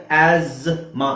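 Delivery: ಆಸ್ಮಾ with a buzzing Z for STH; English pronunciation. Shown as a demonstration. Teacher giving the English form, ಆಸ್ಮಾ said az-ma. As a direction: stretch the Z in ಆಸ್ಮಾ, azz-ma. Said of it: "English ಅಲ್ಲಿ ಹೇಳ್ಬೇಕಾದ್ರೆ".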